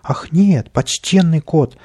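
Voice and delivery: high, drawn-out pleading; frightened. A narrator's reading. Little man faces the cat's sword, frightened. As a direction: plead in a lifted voice, stretching the address. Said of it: "совсем испугался Каприска".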